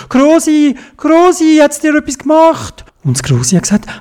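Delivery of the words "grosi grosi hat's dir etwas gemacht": much higher, louder anxious call; worried calling out. A narrator's reading. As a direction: call out in alarm to grandma. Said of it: "hat gerufen". (besorgtes lautes Rufen)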